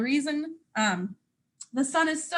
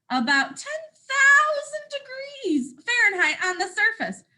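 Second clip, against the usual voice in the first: higher, rising amazed exclaim; excited cry; 10 semitones higher, 3 dB louder than usual. Acted. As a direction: gasp it out in proud amazement. Sun boasts about its heat.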